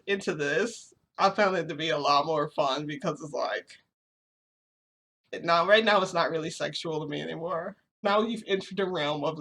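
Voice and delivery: usual speaking voice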